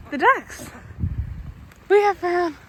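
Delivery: panting after running; out of breath. Real, not acted. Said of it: "I am clearly out of breath".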